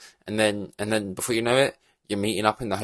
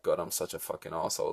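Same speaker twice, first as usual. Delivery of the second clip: much softer, quick muttered self-reproach; self-loathing mutter; 4 semitones lower, 10 dB quieter, faster than usual. Retold or imitated. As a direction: mutter it to yourself in shame. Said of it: "feel like".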